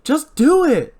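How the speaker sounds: loud, high, exasperated order; sharp, high demand; impatient command. Acted. Julie orders Ray impatiently.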